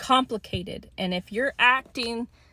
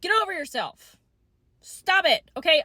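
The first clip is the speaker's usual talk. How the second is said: exasperated; 6 semitones higher than usual. Real, not acted. Angry outburst in rant.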